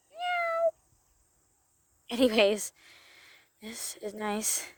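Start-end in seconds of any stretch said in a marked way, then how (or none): 0.1-0.7 high squeaky meow like a kitten; playful kitten meow; cat meow. Pretend. Pretend cat meows.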